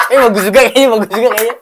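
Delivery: laughing loud and high. Real, not acted. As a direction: laugh through the praise. Laughing approval of the chant.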